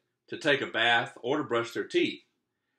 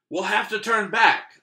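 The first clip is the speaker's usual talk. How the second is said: higher, louder call; 7 semitones higher, 6 dB louder than usual. Acted. Pirate calling to the crew, loud. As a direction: call it across the deck. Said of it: "another called".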